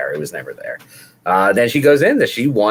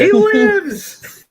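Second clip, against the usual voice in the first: very high, drawn-out cry; mock-dramatic proclamation. Co-host proclaiming Bravo's return, high mock-dramatic cry. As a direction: proclaim a miraculous comeback.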